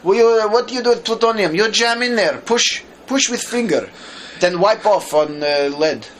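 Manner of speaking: high, loud mock-Russian scientist; Russian accent. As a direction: ham up a Russian accent, giving halting orders. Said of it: "I'll do the Russian accent".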